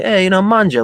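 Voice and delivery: high, loud Italian grandma call; imitating grandma. Italian grandma urging family to eat, imitated loudly. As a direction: call them to the table, pitched well up.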